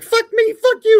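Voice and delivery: hammed-up high-pitched cursing fight; high mock angry squabble. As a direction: squeal it back like a spat. Retold back-and-forth cursing match.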